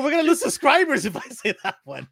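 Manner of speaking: high, loud exaggerated protest; mock alarmed. Husband objecting to wife's suggested joke, mock alarmed. Retelling his protest to wife.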